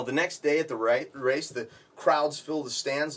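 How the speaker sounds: ordinary talk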